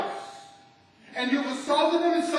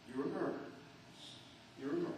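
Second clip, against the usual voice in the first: hushed; 7 semitones lower, 19 dB quieter, much faster than usual. Real, not acted. Repeated hushed verdict.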